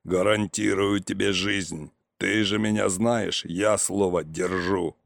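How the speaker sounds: low, slow voice of an opponent; Козлов negotiating. A narrator's reading. Козлов offering uncle his life, low and measured. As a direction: promise it slowly, in a low voice.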